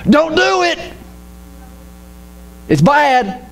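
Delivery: high, stern mock preacher; preacher's warning. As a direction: imitate the preacher forbidding it. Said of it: "he said".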